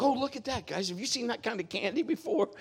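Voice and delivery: high, bright put-on enthusiasm about candy; mock-cheery dad diverting his sons; fake cheerful distraction. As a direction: steer the boys' eyes away with a sing-song. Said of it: "And I'd be like".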